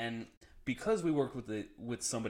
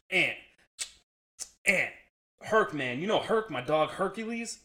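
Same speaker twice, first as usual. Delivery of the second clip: higher, louder, distraught buddy pleading; Ant impression; 6 semitones higher, 6 dB louder than usual. Coworker confides to narrator about his dog, higher, louder, mock-distraught. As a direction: play the upset coworker spilling his worry. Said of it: "I'm gonna try to do my best impression".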